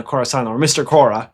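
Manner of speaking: ordinary talk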